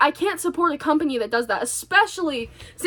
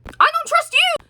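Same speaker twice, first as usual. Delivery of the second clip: high, loud mocking shout; outraged mock repeat; 14 semitones higher, 5 dB louder than usual. Daughter repeating mom's distrust, outraged mocking cry. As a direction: throw the words back in shrill outrage.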